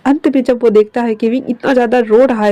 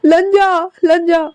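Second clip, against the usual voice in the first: higher, crying shouts; tearful calling; 6 semitones higher, slower than usual. Acted. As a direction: call out searching the field. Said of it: "वो हर जगह चिल्ला रहा था".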